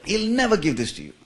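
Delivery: mock the aunt's indignant scolding; upset aunt scolding. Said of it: "She was so upset".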